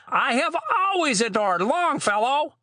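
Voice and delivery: high-pitched gushing aunt; beaming delight. A narrator's reading. Aunt receiving poetry books for Christmas, beaming with delight. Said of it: "she beamed".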